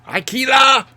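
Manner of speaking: high, loud karate-style yell; Pertwee action cry. Acted. Fan acting out Pertwee in a fight sequence, high action cry. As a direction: shout it like a martial-arts strike. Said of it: "Pertwee's going".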